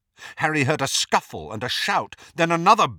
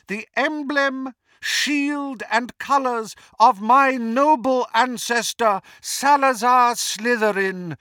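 high, ringing, imperious villain; Voldemort; 11 semitones higher, 5 dB louder than usual. A narrator's reading. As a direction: proclaim the new order to the conquered crowd.

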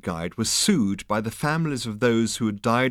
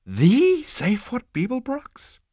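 very high, slow, amazed squeak; awestruck insect; 4 dB louder, slower than usual. Acted. Starstruck insect asking Zaphod, very high. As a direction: gasp it in wonder.

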